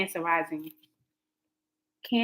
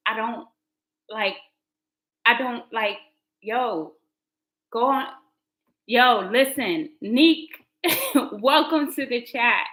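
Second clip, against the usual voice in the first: normal talking voice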